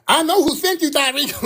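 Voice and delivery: high, whiny accusation; put-on squeaky indignation; mock sassy whiner. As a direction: imitate a sassy man who thinks he knows it all. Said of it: "These sassy little whiny dudes".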